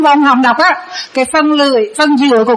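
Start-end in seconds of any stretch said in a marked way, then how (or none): none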